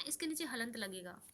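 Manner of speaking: ordinary talk, nothing marked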